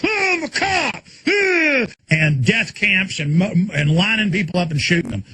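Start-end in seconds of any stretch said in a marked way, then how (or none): 0.0-1.8 high, slow squawk of a cop; mocking a trigger-happy cop in a high squeal. Mocking cops with automatics.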